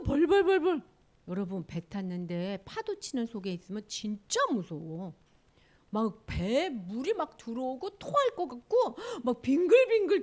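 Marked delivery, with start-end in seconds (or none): none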